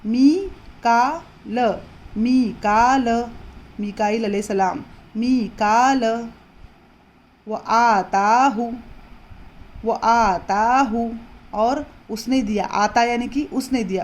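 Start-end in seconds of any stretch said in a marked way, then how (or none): 0.1-3.3 मी का ल broken up slowly, long मी; slow syllables. Shown as a demonstration. Teacher sounding out मीकाइल slowly for students. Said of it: "या मद्दा हो रहा". 5.2-11.2 मी का ल and वो आता हूँ drawn out slowly; slow syllables. Shown as a demonstration. Teacher drilling the class on stretched vowels. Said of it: "या मद्दा हो रहा".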